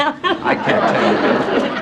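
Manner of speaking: slow, breathy laughing refusal. Real, not acted. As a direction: laugh it out, teasing the host. Coyly refusing to repeat language.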